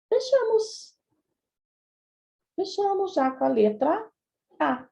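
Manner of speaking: high, pleased "fechamos"; excited announcing. Real, not acted. Teacher announcing the answer to the class, excited. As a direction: pop up the first word with delight.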